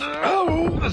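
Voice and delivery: strained grunt under heavy lifting; straining cry. Acted.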